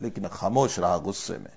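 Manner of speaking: ordinary talk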